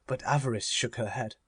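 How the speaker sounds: plain reading voice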